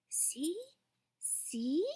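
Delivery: put-on a toddler whispering over the bowl; soft kid's whisper. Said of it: "Fudge whispered".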